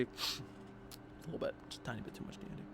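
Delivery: very soft, trailing-off afterthought; hushed admission. Real, not acted. Player confessing after the laugh, very quietly. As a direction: shrink it down to a near whisper.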